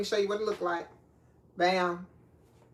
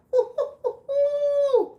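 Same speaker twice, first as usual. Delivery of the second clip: very high, loud "hoo-hoo-hoo"; high hooting; 6 dB louder than usual. Real, not acted. Cook about to cry over shallots, hooting high. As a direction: hoot through the sting in your eyes.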